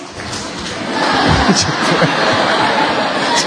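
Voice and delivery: soft laugh. Real, not acted. Priest laughs at mistake.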